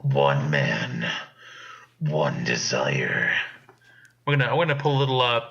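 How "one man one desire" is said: very low, slow trailer announcer; deep epic trailer narration; movie trailer voice. Pretend. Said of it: "give us your movie trailer voice".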